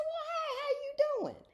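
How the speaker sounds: very high-pitched hello; friendly greeting. Retold or imitated. Judge answering a second shopper's hello, high friendly greeting. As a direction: greet them in an exaggerated high voice. Voices her friendly reply to fan.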